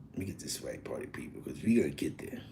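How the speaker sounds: very soft, low aside to himself; quiet aside. Real, not acted. Host getting the track right for party people, quiet low aside. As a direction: keep it far under hype level, low and soft.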